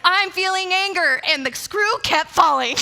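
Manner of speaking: high, loud exasperated outburst; hammed-up furious complaint; anger. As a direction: announce your rage at full pitch. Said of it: "How are you feeling".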